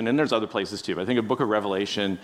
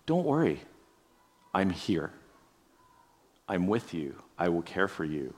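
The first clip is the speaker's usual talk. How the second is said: gentle reassurance; 4 semitones lower, 5 dB quieter than usual. Pretend. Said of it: "more that kind of a tone".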